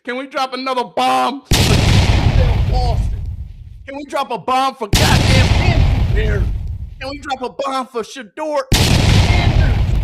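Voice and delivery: roaring hype chant; hype shout. Real, not acted. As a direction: bellow it like a stadium announcer. Hype calls for bombs.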